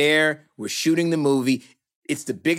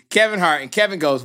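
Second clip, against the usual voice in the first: ordinary talk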